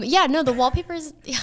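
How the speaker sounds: ordinary delivery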